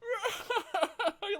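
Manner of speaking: high hammy evil laugh; villain laugh. Retold or imitated. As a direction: cackle like a panto baddie. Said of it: "and he goes".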